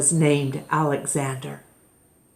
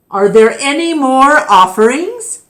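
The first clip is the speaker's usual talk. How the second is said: calling out; 7 semitones higher, 7 dB louder than usual. Acted. Keeper calls to the crowd.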